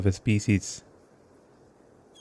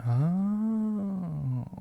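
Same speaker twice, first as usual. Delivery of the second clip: high soft surprised oh; the penny drops, gently; dawning realization; 10 semitones higher, 6 dB quieter than usual. Real, not acted. Streamer realizing the plant is the mother, soft high surprise.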